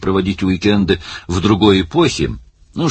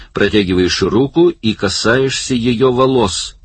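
higher-pitched director calling out orders; bossy film director; 6 semitones higher than usual. Acted. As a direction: direct the cast impatiently over a noisy set. Film director commanding cast and crew. (командный голос режиссёра, повыше)

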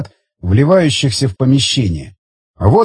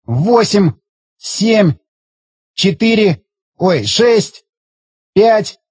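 hysterical countdown; 5 semitones higher, slower than usual. Acted.

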